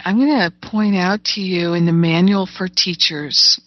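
normal talking voice

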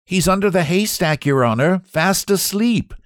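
high-voiced farm lad with a grin; grinning reply. A narrator's reading. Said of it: "replied Isaac with a grin".

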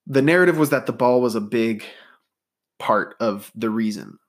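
normal talking voice

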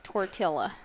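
TORTILLA with a hard English L, tor-TILL-a; imitated TORTILLA rhyming with vanilla; pronounced L's. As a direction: sound the L in TORTILLA. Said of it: "Shane pronounces the L's".

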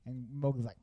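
ordinary talk

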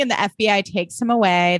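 ordinary talk, nothing marked

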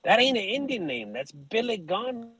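high-pitched imitation of objecting listeners; mock protest. In-laws' name questioned by listeners, high mock protest.

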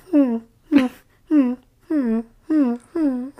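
high, short sleep whimpers; imitated dog-like whimper. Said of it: "whimpers like a dog in her sleep".

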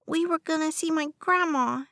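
put-on high, pitiful little-boy voice; slightly trembling. A narrator's reading. West tells a stranger his sob story, trembling slightly. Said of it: "his voice trembling slightly".

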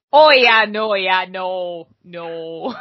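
mock Minnesotan local; imitated Minnesotan accent. Shown as a demonstration. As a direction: trail down through comic Minnesotan agreement. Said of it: "imitate it very well".

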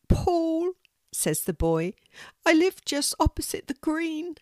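lightly voiced young boy; small boy. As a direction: play a little boy, a bit shy. Hurt boy answers.

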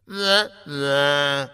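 voiced trombone wah-wah; sad trombone. A narrator's reading. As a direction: imitate a losing-buzzer trombone with held notes. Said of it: "The sound of a trombone's".

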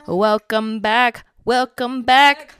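slow, stretched announcer welcome; drawn-out host voice. Shown as a demonstration. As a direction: drag out the welcome like a DJ. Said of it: "I don't know how else to introduce this".

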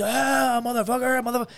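put-on high, strained drunk yelling; aggressive taunt. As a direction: bark it like he's about to swing. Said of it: "he keeps".

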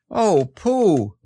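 high-pitched call for a friend; crying out. A narrator's reading. Christopher Robin calls for missing Pooh, crying out. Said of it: "cried Christopher Robin".

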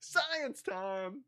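high, rising TV segment announcer. Acted. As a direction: announce it like a kids' science show.

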